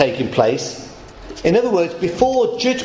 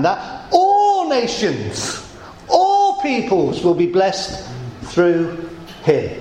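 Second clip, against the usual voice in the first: slow emphatic proclamation; much slower than usual. Real, not acted. Sermon emphasis on promise.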